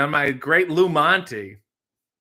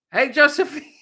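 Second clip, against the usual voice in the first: calling out; 11 semitones higher, 7 dB louder than usual. Pretend. Calling the dog's new name.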